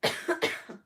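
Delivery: loud chesty cough from a sick throat; harsh cough. Real, not acted. Sick reader breaks off to cough loudly. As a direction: hack it out hard. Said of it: "my chest screams at me".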